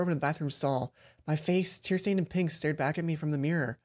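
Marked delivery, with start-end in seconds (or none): none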